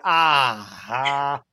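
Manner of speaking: long, sinking sigh of relief; blissful sigh. Retold or imitated. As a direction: melt into a drawn-out "ahh". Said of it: "the warmth would spread throughout my body".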